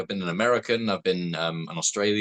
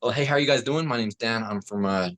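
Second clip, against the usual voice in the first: General American introduction; American accent; 2 semitones higher than usual. Shown as a demonstration. Actor demonstrates for podcast hosts on request, American accent. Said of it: "American accent, cool. Dan in the American".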